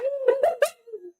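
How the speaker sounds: high laugh. Real, not acted. Reacting to the clip.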